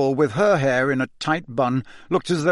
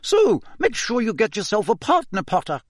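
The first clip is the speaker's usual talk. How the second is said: high, clipped Scottish schoolmistress; a strict old teacher addressing the class; stern McGonagall; 9 semitones higher than usual. Acted. Teacher announcing the ball to the class, stern and high.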